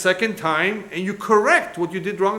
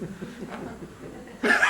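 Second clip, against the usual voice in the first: amused laugh; 3 semitones higher than usual. Real, not acted. Speaker laughs at the story.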